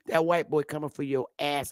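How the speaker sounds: usual speaking voice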